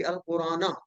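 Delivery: अल कुराना with an "-a" after the n; joined-reading ending. Shown as a demonstration. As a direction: end अल कुराना on an open "na", as if reading on. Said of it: "मिला के पढ़ेंगे".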